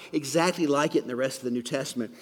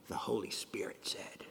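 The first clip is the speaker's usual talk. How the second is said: whispered, much softer; hushed reveal; 8 semitones lower, 12 dB quieter than usual. Real, not acted. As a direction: breathe it out like a secret.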